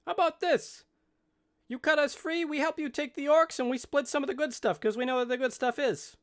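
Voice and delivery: high-pitched little halfling voice; bargaining halfling. Acted. Captive halfling offers the party a deal, high squeaky bargaining voice. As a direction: pitch it way up, like a small creature making a deal.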